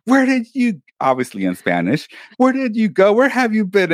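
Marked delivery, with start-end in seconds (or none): 0.1-0.8 high-pitched mock scolding; scolding mom imitation. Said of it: "and she'd be like". 2.4-3.9 high-pitched mock scolding; scolding mom imitation. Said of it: "and she'd be like".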